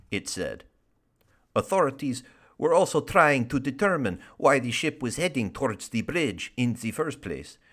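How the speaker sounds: wobbly put-on Russian accent. Host carrying on the boat story, shaky attempt at a Russian accent. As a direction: keep up the amateur Russian news read. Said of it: "if I can do a Russian accent".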